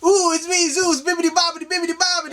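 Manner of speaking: high, sing-song mock Italian god voice; bibbidi-bobbidi Zeus. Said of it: "had a bibbidi bobbidi boo like voice".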